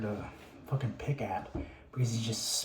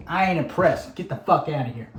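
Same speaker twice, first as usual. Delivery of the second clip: louder, higher barking dismissal; drill sergeant; 4 semitones higher, 7 dB louder than usual. Retold or imitated. Drill sergeant dismissing a recruit, loud mock bark. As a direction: snap him out of the room like a drill sergeant. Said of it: "It's like".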